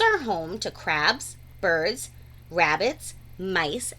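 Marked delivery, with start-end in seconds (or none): none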